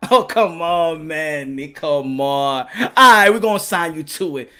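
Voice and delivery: low, soft, reluctant executive; Al Heyman. Acted. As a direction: give in quietly, deep in the voice. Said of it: "Al Heyman's like".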